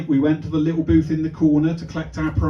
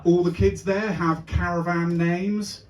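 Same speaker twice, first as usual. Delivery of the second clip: normal talking voice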